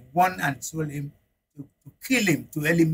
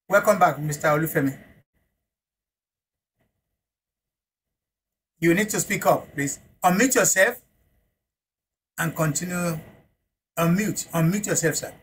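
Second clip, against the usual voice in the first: raised voice, calling out; to someone hard of hearing; 3 semitones higher than usual. Real, not acted. Host calling to a muted guest.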